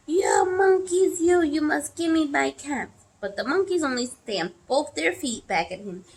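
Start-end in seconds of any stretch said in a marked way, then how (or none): 0.1-3.0 angry shout. A narrator's reading. Peddler, very angry, to the monkeys, shouting. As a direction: shout it in full stamping exasperation. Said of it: "He stamped both his feet and shouted".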